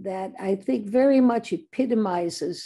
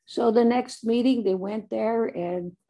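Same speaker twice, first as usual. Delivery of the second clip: ordinary delivery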